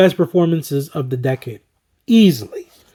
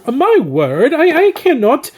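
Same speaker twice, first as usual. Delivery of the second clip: lampoon a pompous critic, pitched way up; snooty film snob; 5 semitones higher than usual. Retold or imitated. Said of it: "like, obnoxious film snobs".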